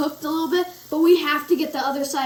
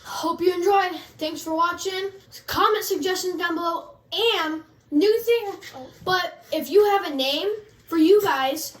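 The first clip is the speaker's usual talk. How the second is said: upbeat, louder YouTube sign-off; show outro; 5 semitones higher, 4 dB louder than usual. Acted. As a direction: wrap up the video like a host.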